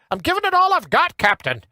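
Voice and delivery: put-on high-pitched Scottish accent; Scotty impression. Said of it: "Remember Scotty from Star Trek?".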